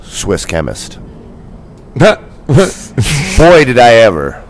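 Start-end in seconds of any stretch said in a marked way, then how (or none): 2.0-4.4 loud, high burst of relish; gleeful emphasis. Real, not acted. Traveler recalling finding a Swiss chemist, loud glee. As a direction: exclaim it with big relish.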